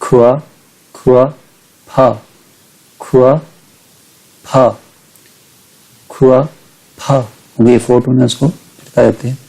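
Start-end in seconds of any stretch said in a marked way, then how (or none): 0.0-7.3 खुआ as half third tone, then short फा. Shown as a demonstration. Teacher giving another example, खुआ halved before फा. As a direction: separate खुआ and फा in a careful tone drill. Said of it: "ऐसे".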